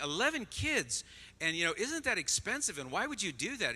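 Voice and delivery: high-pitched, incredulous questioning; mock disbelief. Waitresses reacting to a family with eleven kids, mock disbelief.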